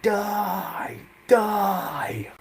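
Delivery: breathy, vicious whispered threat; menacing whisper. Retold or imitated. Rollins locking Punk in the hold, menacing whisper. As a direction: hiss it like a villain. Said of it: "He was like".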